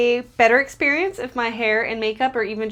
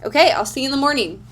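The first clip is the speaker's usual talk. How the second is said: usual speaking voice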